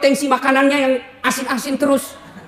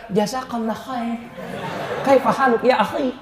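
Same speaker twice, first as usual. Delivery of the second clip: mock pious greeting; 4 dB quieter than usual.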